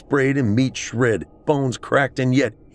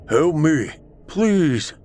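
high, pleading call for help; Trey/character; 7 semitones higher, 3 dB louder, much slower than usual. A narrator's reading. Beast mimicking Trey to Celeste, high desperate plea. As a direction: plead urgently, pitched up. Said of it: "It was Trey's voice".

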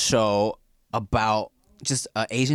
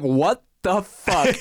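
slow, higher, drawn-out mock outrage; retold disbelief; 4 semitones higher, much slower than usual. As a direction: stretch each word in baffled disbelief. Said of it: "afterwards, we were like".

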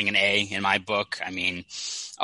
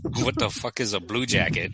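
put-on horrible Russian accent. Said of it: "my horrible, I'm not gonna do a Russian accent".

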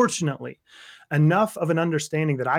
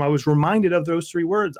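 usual speaking voice

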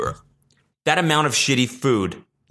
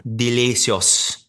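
put-on Italian accent; much slower than usual. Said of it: "doing the Italian thing".

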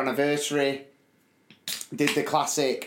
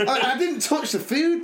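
high laugh at his own misery; cackling while telling it; 11 semitones higher than usual. Real, not acted. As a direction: laugh through it, much higher.

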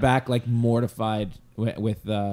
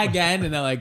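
normal talking voice

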